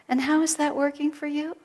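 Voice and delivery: gentle high question, rising; sweetly. Retold or imitated. Healer gently asking a stubborn student, sweetly. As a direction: ask it sweetly, with a lift. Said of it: "so sweetly she said".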